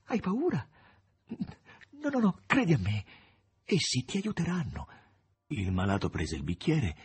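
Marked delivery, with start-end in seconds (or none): 0.1-4.9 high, anxious coaxing; trembling voice. A narrator's reading. Margherita urging the master to drink, high and trembling. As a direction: coax him high and trembling. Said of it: "con voce tremante".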